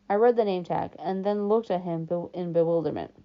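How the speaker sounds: ordinary delivery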